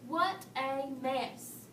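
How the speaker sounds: usual speaking voice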